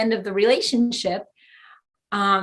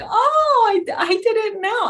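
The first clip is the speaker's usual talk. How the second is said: high, sheepish little gasp of realization; sheepish surprise; 12 semitones higher, slower than usual. Retold or imitated. Telling the group how she blushed at her mistake, high and sheepish. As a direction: play the embarrassed newcomer caught out. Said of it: "I'm like".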